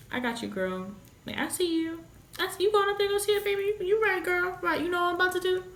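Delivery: high-pitched, chatty heavenly helper; reassuring voice from above. Acted. Somebody up there cheering her on, high and chummy. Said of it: "somebody up there that's like".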